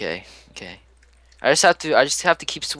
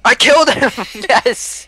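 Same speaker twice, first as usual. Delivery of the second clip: high, loud cry of victory; triumphant shout; 10 semitones higher, 5 dB louder, slower than usual. Real, not acted. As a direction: cheer the kill in disbelief. Just won the fight.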